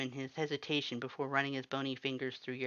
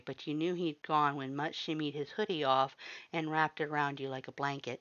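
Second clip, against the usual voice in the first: ordinary delivery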